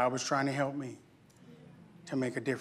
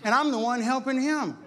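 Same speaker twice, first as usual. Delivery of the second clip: high, slow, offended whine; mock outrage at the ungrateful man; indignant complaint to God; 6 semitones higher than usual. Preacher retelling his prayer, high offended whine. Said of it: "I'm like".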